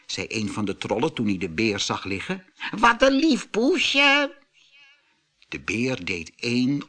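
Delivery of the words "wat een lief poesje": troll voice. A narrator's reading. Troll mistakes bear for cat.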